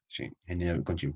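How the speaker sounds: ordinary talk, nothing marked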